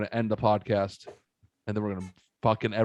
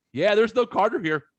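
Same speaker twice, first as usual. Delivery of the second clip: mock mother-in-law; 8 semitones higher, 4 dB louder than usual. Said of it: "mother-in-law ... going".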